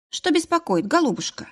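high, sweet cooing to a patient; cooing baby talk. Acted. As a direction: coo it like talking to a baby. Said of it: "Сюсюканье вырывалось из меня".

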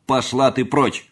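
loud, high, irritated dismissal; cross servant scolding at full voice; angry shout. A narrator's reading. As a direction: shout the maid away crossly. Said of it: "сердито закричал на неё дородный лакей".

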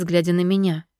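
ordinary delivery

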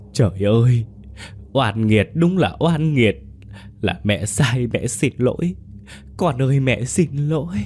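high, anguished crying apology; wailing in despair. Acted. Mother wails her apology to the ghost, sobbing. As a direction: wail like a broken child. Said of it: "Bà Vân khóc rống lên như đứa trẻ".

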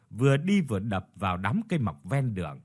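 plain reading voice

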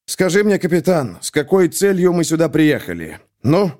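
stern colonel's mild roar; thundering. A narrator's reading. Colonel grilling his captain, mildly thundering. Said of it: "загремел Кисаев".

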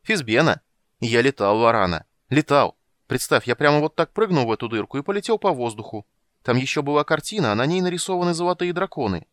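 loud, high, bubbling over; breathless boasting about flying; proud, excited kender. A narrator's reading. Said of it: "сказал Кендер гордо".

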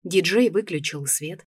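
ordinary delivery, nothing marked

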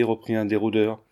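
ordinary delivery, nothing marked

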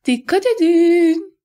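high, slow singsong call; trilled warning. A narrator's reading. Baroness warns the others about the third man, trilling loud and high. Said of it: "diye şakadı barones".

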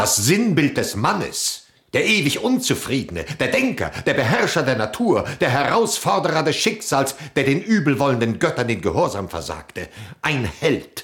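rising to a loud, high hymn of praise; exalted praise. Acted. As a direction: proclaim the hero with soaring pride. Extols Odysseus as a hero.

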